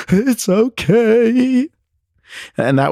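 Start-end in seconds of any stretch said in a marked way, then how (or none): 0.1-1.7 very high, loud, long-drawn wail; hammed-up tearful falsetto; mock sobbing. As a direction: imitate a sobbing little girl squeaking out the words. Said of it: "she's sobbing".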